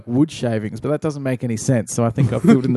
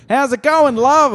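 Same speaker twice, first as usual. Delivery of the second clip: higher, louder mock blokey greeting; old bloke's greeting; 13 semitones higher, 4 dB louder, slower than usual. Old dudes at the checkout greet a long-haired cashier, higher and louder. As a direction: ham up a leering old customer. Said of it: "old dudes would come down the aisle and go".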